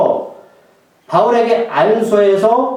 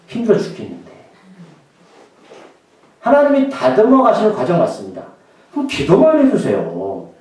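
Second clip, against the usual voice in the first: normal talking voice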